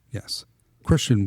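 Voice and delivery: ordinary talk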